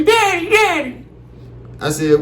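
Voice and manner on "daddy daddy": put-on high, slow child calling Daddy; sing-song little kid call; small daughter at dad's bedside; little girl voice.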